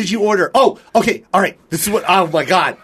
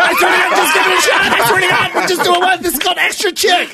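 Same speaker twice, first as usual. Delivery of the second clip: high, frantic mock sales pitch; insistent friend; 11 semitones higher than usual. Imagining his friend coaxing a date, high frantic insistence. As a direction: plead and oversell, pitched way up. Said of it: "and he's like".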